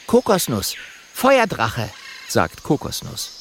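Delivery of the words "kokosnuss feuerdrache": high, proud little dragon voice; high-pitched dragon character. Acted. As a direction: introduce yourself as the small dragon. Little dragon introduces himself.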